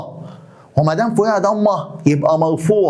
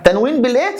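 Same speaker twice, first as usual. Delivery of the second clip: rising prompt on بالايه, waiting for the class; teacher's fill-in-the-blank prompt; prompting question; 3 semitones higher than usual. Shown as a demonstration. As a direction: ask بالايه with a lifted, open end. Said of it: "teacher prompts class".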